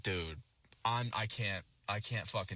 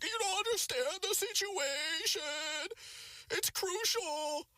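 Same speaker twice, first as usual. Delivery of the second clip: put-on very high, slow frail old lady voice; squeaky granny pleading; old woman voice; slower than usual. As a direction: mimic a panicked old woman, high and drawn out. Boomer voiced again.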